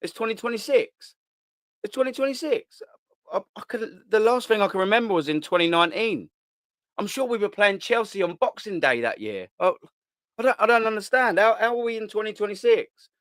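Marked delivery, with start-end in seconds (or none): 1.8-2.8 higher, more astonished second ask; louder disbelief. Retold or imitated. Fan rereading the newspaper date, higher astonishment. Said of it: "repeated take". 3.3-13.1 lightly voiced, higher bewildered fan; a hint of a lost sleeper waking up; confused fan. Fan waking from the spell, mildly confused and higher.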